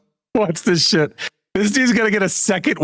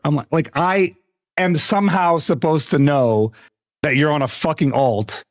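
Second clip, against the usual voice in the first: ordinary delivery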